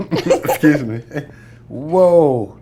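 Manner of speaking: high, loud, laughing outcry; flustered exclamation. Real, not acted. Caught off guard by the host, loud high laughing outcry. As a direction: react big to being put on the spot. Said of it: "threw that curveball at me".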